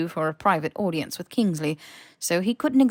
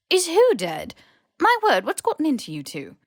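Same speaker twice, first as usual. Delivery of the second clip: higher, louder startled question; alarmed old headmistress; flustered, startled disbelief; alarmed professor; 9 semitones higher, 5 dB louder than usual. A narrator's reading. McGonagall alarmed, voiced character.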